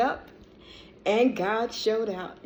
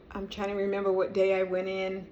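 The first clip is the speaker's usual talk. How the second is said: usual speaking voice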